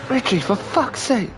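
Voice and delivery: high, slow, fed-up groan at the dog; high slow exasperated scolding. Retold or imitated.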